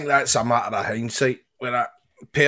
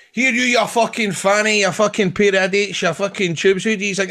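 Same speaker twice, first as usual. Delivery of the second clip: hammed-up Scottish accent; 5 semitones higher than usual. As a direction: show off a broad Scottish accent. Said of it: "I think I got the best Scottish accent ever. Can I have a, can I give it a bash".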